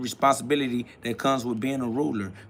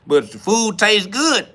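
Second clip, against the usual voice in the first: higher, slow, teasing voice of someone pushing food; hammed-up tempting food pusher; mock food tempter; 6 semitones higher, 3 dB louder, slower than usual. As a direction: drag it out, coaxing and high. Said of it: "y'all talking about".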